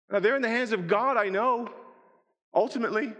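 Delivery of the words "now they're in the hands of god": ordinary talk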